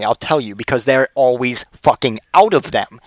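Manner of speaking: normal talking voice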